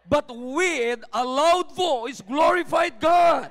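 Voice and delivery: loud, slow cry building to a shout; drawn-out preacher's shout of praise. Acted. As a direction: proclaim it, peaking on the last words. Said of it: "He's not whispering".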